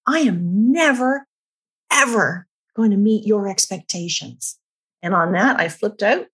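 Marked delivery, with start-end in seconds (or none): 0.1-4.6 high, pained mock yell, slow on I am never; lightly replaying a furious outburst at him; retold scream at husband. Wife replaying her outburst at her husband, mild mock yell. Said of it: "I started screaming at him to say".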